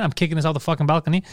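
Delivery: normal talking voice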